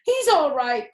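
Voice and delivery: loud, high anxious cry; cried out. Acted. Zuckerman crying out to the crowd that his pig is fine. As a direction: call out to reassure the crowd. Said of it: "cried Mister Zuckerman".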